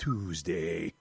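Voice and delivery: very low, soft comic boom; deep mock-announcer punchline; put-on Tuesday voice. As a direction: drop way down and draw it out. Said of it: "I even did the voice".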